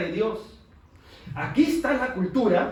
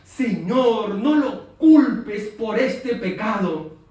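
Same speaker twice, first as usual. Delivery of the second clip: louder, very slow plea; mild retold cry; 8 dB louder, much slower than usual. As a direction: cry it out slowly to the Lord. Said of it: "cayó de rodillas gritando".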